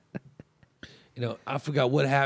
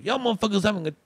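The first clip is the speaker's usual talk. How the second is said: a bit higher, louder gruff uncle voice; calmed down; 5 semitones higher, 6 dB louder than usual. Retold or imitated. Uncle calming down at the scene, gruff and amused. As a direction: grumble then decide to join in. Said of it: "he kinda calms down".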